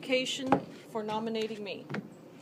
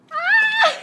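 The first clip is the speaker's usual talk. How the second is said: loud high shriek from cold shock; shriek from ice cold water; 16 dB louder than usual. Real, not acted. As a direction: scream as freezing water hits. Ice water dumped on head.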